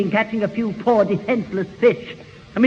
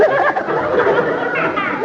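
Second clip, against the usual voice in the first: polite forced laugh; 12 semitones higher than usual. Acted.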